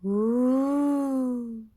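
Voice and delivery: loud, long, high ghostly ooh; a swooping ghost's call. Acted. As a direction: ghost calling as it swoops low overhead. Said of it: "swooped low and called".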